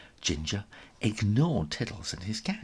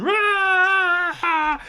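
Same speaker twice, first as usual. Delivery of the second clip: much higher, louder rah; hammed-up battle roar; crowd roar; 7 dB louder than usual. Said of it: "the whole school joined in".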